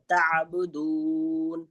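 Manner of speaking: تعبدون very slowly, the dal vowel drawn long; elongated recitation. Shown as a demonstration. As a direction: stretch the دو in تعبدون. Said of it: "پھر دال کو کھینچ کے پڑھیں گے".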